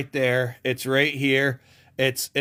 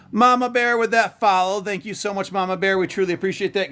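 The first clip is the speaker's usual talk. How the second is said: higher, bright thank-you to a follower; cheerful thanks; 6 semitones higher than usual. Real, not acted. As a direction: thank her with a lift.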